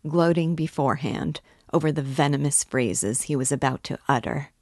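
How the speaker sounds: usual speaking voice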